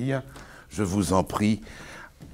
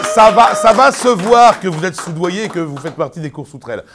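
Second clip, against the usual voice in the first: loud, high theatrical outburst; hammy stage actor; 7 semitones higher, 12 dB louder than usual. Acted. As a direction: declaim it like a furious stage actor. Dramatic outburst opening the video.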